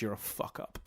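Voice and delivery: quiet unvoiced sneer of a father; mock dismissive father. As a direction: toss it off under your breath. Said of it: "Jake basically just says".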